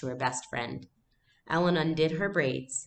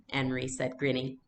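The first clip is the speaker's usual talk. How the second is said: ordinary delivery, nothing marked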